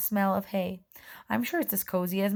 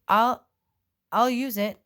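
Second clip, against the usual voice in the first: shaking voice; 4 semitones higher, 6 dB louder, slower than usual. A narrator's reading. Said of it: "His voice is shaking a little too".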